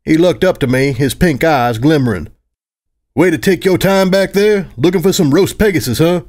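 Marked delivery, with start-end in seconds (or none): 3.1-6.2 teasing. Acted. Flare teasing Hyde.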